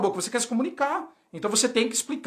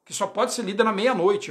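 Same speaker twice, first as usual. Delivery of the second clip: ordinary delivery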